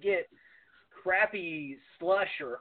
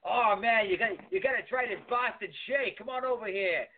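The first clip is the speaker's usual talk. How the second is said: lightly voiced, higher eager uncle; 7 semitones higher than usual. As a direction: pitch it up like a pushy uncle selling a treat. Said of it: "he goes".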